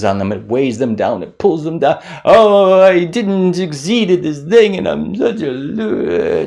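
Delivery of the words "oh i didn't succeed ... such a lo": mock self-pity. Mocking self-pitying failure.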